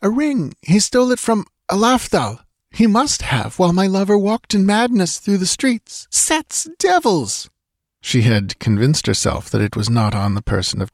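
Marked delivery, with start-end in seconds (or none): 0.0-7.5 put-on frantic young woman. Dancer searching for her lover's stolen ring, frantic high voice.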